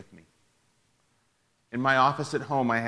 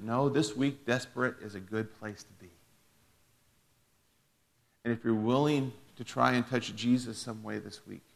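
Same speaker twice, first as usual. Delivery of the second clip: ordinary talk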